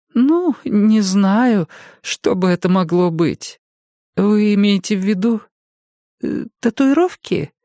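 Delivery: высокий голос пожилой женщины; мягкая разговорчивая старушка. A narrator's reading. Jenny hesitates. (голос старушки)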